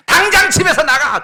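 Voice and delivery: high, loud furious yell; furious shout. Pretend. Father ordering his ungrateful son out, shouting furiously. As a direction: throw him out of the house.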